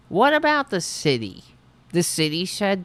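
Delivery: usual speaking voice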